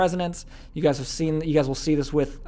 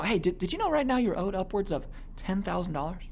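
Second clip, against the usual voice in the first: soft, confidential sales question; lowered voice; 3 semitones higher, 7 dB quieter than usual. Shown as a demonstration. Salesman bringing a prospect in, lowered voice. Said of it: "I'm kind of lowering my voice level".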